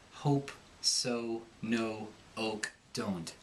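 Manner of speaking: plain American vowels, o as oh; American accent. Shown as a demonstration. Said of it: "Americans will say".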